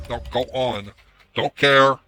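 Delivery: ordinary talk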